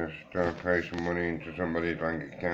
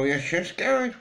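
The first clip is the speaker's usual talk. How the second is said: much higher, sing-song yes, yes; mock cheer; 13 semitones higher, 4 dB louder, slower than usual. Announcing the trip to the guys, high and chirpy. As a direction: pitch it up like a chirpy greeting. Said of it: "And then we're gonna go".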